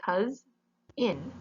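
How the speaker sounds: "cous" and "in" split by a pause; divided into syllables. Shown as a demonstration. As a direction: break cousin into "cous", pause, "in". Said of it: "where you divide it".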